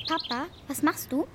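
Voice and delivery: ordinary delivery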